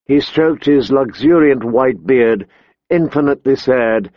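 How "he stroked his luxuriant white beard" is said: ordinary delivery, nothing marked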